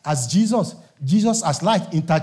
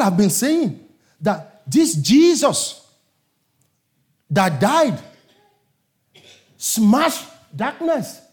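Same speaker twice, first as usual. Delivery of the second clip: full-force preaching; 4 semitones higher than usual. Acted. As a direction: build the fire of the sermon. Preaching climax about Jesus.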